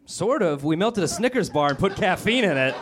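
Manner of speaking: hammed-up Dunkin clerk; mock clerk. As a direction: admit it sheepishly like a counter kid.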